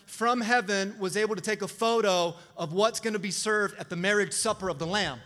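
ordinary talk, nothing marked

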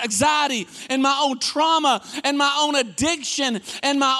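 high, rising preacher cadence; preaching. Acted. Pastor pressing the congregation, in full preaching cadence. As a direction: build the sermon with fervor.

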